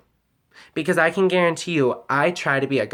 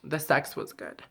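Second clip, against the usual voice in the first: much softer, stunned echo of his words; hurt, quiet repeat; 8 dB quieter than usual. Real, not acted. Repeating her ex's answer to herself, hurt and quiet.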